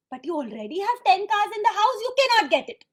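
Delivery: parent getting angry. Acted. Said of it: "Now you're getting angry".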